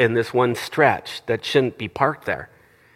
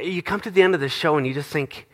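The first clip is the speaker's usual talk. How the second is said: usual speaking voice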